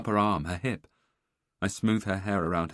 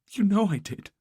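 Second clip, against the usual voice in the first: tearful insistence; 14 semitones higher, 7 dB louder than usual. Acted. Wife reveals miscarriage, upset.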